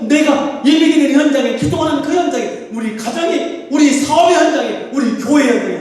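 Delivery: high, fervent pulpit climax; impassioned revival preaching; preaching at full force. Real, not acted. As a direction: drive each phrase upward with urgency. Climax of exhortation.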